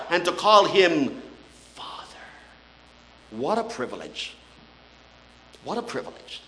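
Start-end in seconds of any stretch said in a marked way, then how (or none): 1.7-2.2 hushed Father. Real, not acted. Preacher hushes on the name. 3.3-6.4 low, hushed, marvelling; hushed awe. Real, not acted. Preacher marvelling softly to his congregation, hushed and low.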